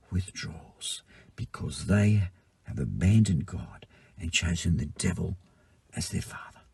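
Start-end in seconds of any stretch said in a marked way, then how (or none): 1.3-6.6 soft whispered aside; hushed, grave whisper. Acted. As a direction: drop to a secret whisper. Hushed aside about the devil.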